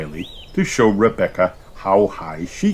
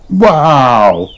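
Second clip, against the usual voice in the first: much louder astonished wow; amazed gasp; 6 semitones higher, 10 dB louder than usual. A narrator's reading. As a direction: blurt it out in awe. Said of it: "gasped Pedro".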